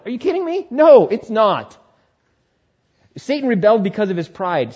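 ordinary talk

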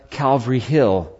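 ordinary delivery

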